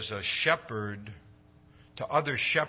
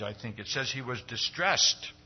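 normal talking voice

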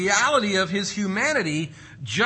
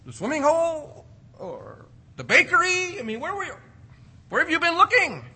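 high-pitched, baffled rhetorical questions; incredulous mock questioning; 11 semitones higher than usual. Boy Jesus asking parents where they searched, incredulous. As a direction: pitch it way up in playful disbelief.

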